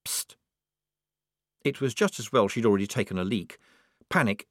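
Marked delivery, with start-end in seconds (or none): 0.0-0.3 soft, hissed psst; furtive psst. A narrator's reading. Hidden stranger secretly calling to Polly, furtive psst. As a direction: get their attention with a hushed psst. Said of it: "Someone said".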